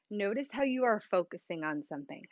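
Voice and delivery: ordinary delivery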